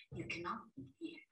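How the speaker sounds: soft unvoiced whisper. A narrator's reading. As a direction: hush to listen. Narrator hushes listeners.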